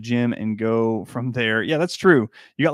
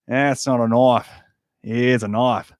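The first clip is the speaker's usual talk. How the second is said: slow, louder mock Australian accent; lightly done Crocodile Dundee Australian drawl; 4 dB louder, slower than usual. Australian accent, showing off for his wife's favourite impression. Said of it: "my Australian accent from Crocodile Dundee".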